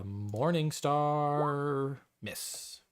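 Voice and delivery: very slow, stretched reveal; drawn-out suspense. Real, not acted. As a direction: stretch the words out before the result. Game master draws out attack result.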